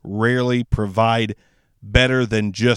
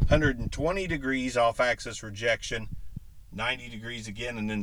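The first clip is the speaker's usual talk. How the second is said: much softer, turned away from mic; off-axis; 11 dB quieter than usual. Shown as a demonstration. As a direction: speak off to the side of the mic. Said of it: "turn the mic ninety degrees".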